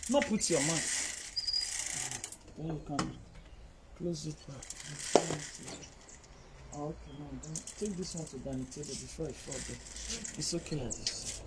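quiet off-mic aside; muttered aside. Real, not acted. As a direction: say it off to the side.

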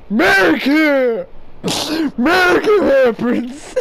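loud hammed-up cry of America; over-the-top flag-waving yell; mock patriotic shout. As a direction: bellow it like a parody patriot.

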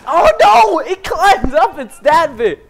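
shocked yelp that breaks into laughter; laughing alarm. Real, not acted. Gets stabbed, laughing alarm.

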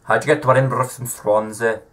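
quick put-on Scottish accent, lightly done; attempted Scottish accent. Quizzing Siri on trains in an amateur Scottish accent. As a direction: rattle off the question in a mock Scottish accent. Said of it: "me trying to do a Scottish accent".

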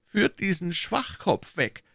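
dismissive command. Acted. Cardinal having the fool led away, commanding. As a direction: order him out with slow disdain. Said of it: "befahl der Kardinal".

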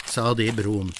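usual speaking voice

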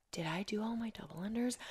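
hushed, whispered question; whispered doubt. Retold or imitated. Athlete checking with the judge after her workout, whispered doubt. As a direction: whisper it as a dawning worry.

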